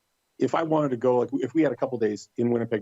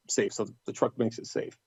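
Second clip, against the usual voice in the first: usual speaking voice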